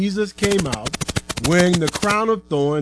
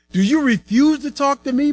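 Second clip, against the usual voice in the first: high, loud, affronted question; indignant Pilate; 10 semitones higher, 5 dB louder than usual. A narrator's reading. Pilate questions Jesus who gave no answer, indignant. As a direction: demand an answer, pitched well up.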